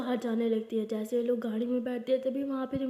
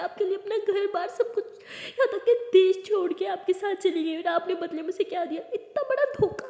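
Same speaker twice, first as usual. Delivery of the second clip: anguished accusation; 6 semitones higher than usual. Acted. Aliya accusing uncle of betrayal.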